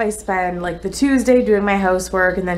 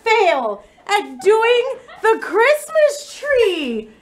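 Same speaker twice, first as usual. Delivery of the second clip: much higher, slow laughing wail; tearful whine; 11 semitones higher, slower than usual. Real, not acted. To her partner over the third failed tree, tearful wail. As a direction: wail it out, half laughing, half crying.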